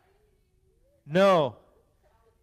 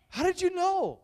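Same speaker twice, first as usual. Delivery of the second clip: much higher, squeaky astonished question; mock amazement; 12 semitones higher than usual. Pastor praising a kid's guess, mock amazement. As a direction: overplay the surprise.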